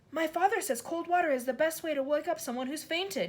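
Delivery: put-on know-it-all child; eager boy. A narrator's reading. Nigel explains eagerly.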